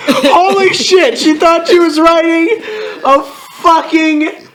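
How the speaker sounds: high, laughing incredulity; amused disbelief. Real, not acted. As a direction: exclaim high through laughter. Amazed at the misspelling.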